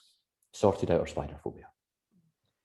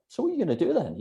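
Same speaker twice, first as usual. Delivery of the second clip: high, questioning dentist voice; lightly voiced dentist asking what next; a dentist pressing his patient; quoting the dentist; 12 semitones higher, faster than usual. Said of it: "the dentist sort of said".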